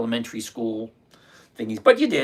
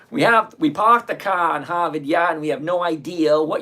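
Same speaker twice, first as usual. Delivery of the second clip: put-on Boston accent, r's dropped, a bit louder; 3 semitones higher, 5 dB louder than usual. Boston accent, echoing the viewer's joke back. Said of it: "that's Bostonian for you".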